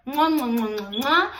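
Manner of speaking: smacking kiss sounds; blown kisses. Real, not acted. To her viewers, blowing smacking kisses. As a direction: blow kisses to the camera.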